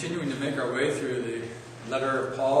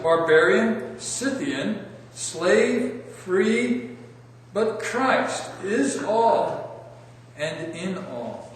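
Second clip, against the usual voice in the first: slow, raised, projected Bible reading; measured public reading, louder and higher, each phrase set apart; scripture reading; 5 semitones higher, 4 dB louder, slower than usual. Real, not acted. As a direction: read the passage aloud to a congregation, deliberate and emphatic. I'd like to once more read.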